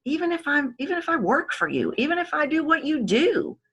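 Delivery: high unvarying rattle; fast, same note. Shown as a demonstration. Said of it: "go fast and everything has the same note".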